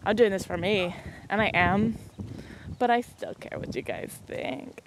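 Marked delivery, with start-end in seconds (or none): 2.8-4.8 quiet confession. Real, not acted. Confessing she still cares.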